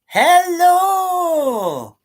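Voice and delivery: loud, high, long-drawn hello; calling out a greeting. Real, not acted. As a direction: holler hello as someone arrives. Calls out greeting to arriving viewer.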